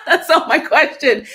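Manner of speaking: high burst of real laughter; laughing. Real, not acted. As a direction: laugh right through the words. Laughs at herself.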